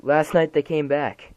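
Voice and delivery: the mother breaks down shouting it; crying out. A narrator's reading. Frightened mother to the officer, crying out. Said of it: "She started to cry".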